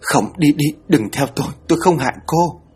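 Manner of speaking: sick woman raving in fever at the ghost; feverish delirious plea. Acted. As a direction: beg the ghost away in delirium. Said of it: "vừa sốt vừa nói nhảm".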